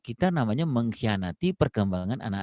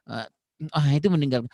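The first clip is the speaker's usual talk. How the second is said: ordinary talk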